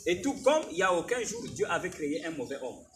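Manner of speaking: fervent sermon delivery, rising and loud; preaching. Acted. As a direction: preach it to the congregation. Prédication en chaire. (prédication fervente)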